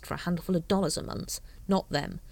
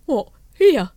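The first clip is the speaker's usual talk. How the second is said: incredulous; 8 semitones higher, 12 dB louder than usual. A narrator's reading.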